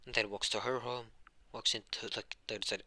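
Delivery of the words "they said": ordinary delivery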